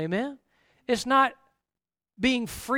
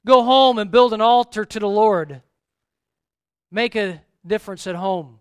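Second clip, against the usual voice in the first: loud command; 8 dB louder than usual. Acted. God commands Gideon.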